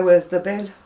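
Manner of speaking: BIN for "been", short i; thick dialect, "been" shortened to bin; local dialect. Shown as a demonstration. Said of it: "it's just my dialect".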